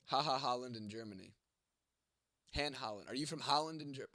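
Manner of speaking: ordinary talk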